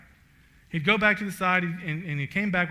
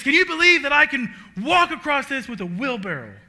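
showman's challenge; 8 semitones higher, 10 dB louder than usual. Retold or imitated. Blondin challenges crowd.

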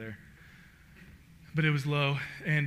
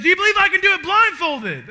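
much higher and louder, teasing the crowd; showman's challenge; 13 semitones higher, 13 dB louder than usual. Retold or imitated. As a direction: dare them, loud and high.

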